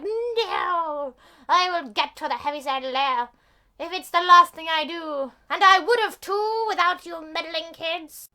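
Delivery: loud, high, melodramatic vow; hammed-up villain. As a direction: rage like a thwarted cartoon villain. Defeated villain vows revenge.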